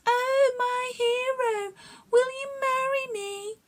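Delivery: hammed-up lovestruck falsetto; bully mocking the bee with a swooning high voice; silly high voice. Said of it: "putting on a silly high voice".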